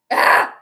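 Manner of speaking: tense yelp. Real, not acted. Final seconds of the game.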